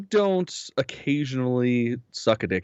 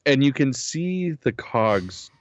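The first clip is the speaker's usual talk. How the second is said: ordinary talk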